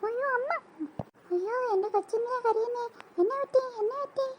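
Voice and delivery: high, soft worried fuss; fussing mother. Acted. Mother fussing over her crying daughter. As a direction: fret over the hurt child.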